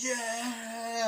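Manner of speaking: long, high-pitched drawn-out "yeah"; mock crowd roar held high; imitated crowd cheer. Crowd cheers as Kongs appear.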